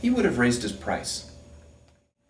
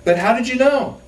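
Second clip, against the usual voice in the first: shouting back; 9 dB louder than usual. Acted. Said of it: "he says".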